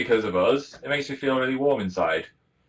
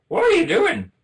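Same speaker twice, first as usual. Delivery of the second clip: high-pitched, loud bad Scottish impression; hammed-up Scottish accent, high and loud; terrible Scottish accent; 6 dB louder than usual. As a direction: badly imitate shocked Scotsmen, pitched way up. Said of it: "terrible impression of Scot-, Scottish accent".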